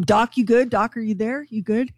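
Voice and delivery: ordinary talk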